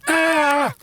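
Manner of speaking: sudden high loud scream of fright; sharp high yelp of fear; startled scream. Acted. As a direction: shriek in shock together. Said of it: "they screamed in unison".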